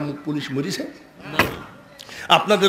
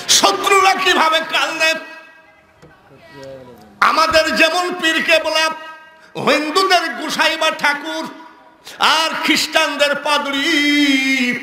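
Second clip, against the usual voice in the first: high, ringing sermon delivery; full-force preaching; 12 semitones higher than usual. Acted. Preacher asks the crowd about weeping enemies, preaching at full force.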